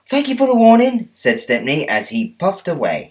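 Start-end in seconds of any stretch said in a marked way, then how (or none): 0.1-1.1 eager little engine thanks; high quick thankful engine; high, fast grateful engine. Acted. Stepney replies.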